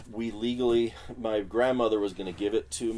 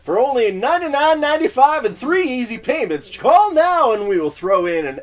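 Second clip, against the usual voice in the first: much higher and louder, a hammed-up TV pitchman; over-the-top commercial voice; 13 semitones higher, 13 dB louder than usual. Dubbing a TV ad for a Finnish film, hammed-up pitchman read. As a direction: sell it like a cheesy late-night ad. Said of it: "I did the voice of that commercial".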